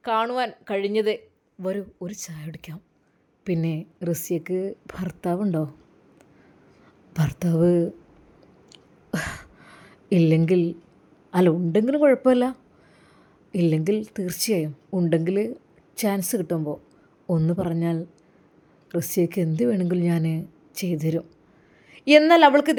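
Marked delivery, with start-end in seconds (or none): 1.6-2.8 lower, much softer whispered tea offer; whispered invite. Acted. Villain quietly lures Rasiya to tea, whispering. 3.5-21.2 low, oily, probing man; a creep angling for favours; sleazy villain. Acted. Lecherous man sounds out Rasiya's marriage, low and sleazy.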